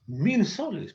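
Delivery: acted customer's shock; indignant disbelief. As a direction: balk at the price, pitch jumping up. Cliente protesta por el precio.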